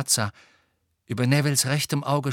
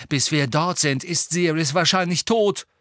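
raised, louder heated retort; angry retort; 6 semitones higher, 5 dB louder than usual. A narrator's reading. Harry furious at Hermine's plan, angry retort. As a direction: throw it back in frustration. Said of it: "trat in seiner Wut".

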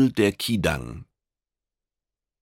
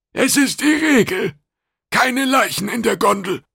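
high, loud creature voice; insistent alien official; 7 dB louder than usual. A narrator's reading. Customs officer refusing corpses, high and insistent. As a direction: lay down the rule, high and loud. Kidang figure insists on the rule.

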